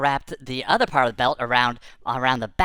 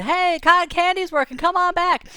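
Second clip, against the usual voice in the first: high call out to the crowd; a fair vendor hollering folks back; yelling out; 3 dB louder than usual. Retold or imitated. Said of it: "So the guy yells out to everybody".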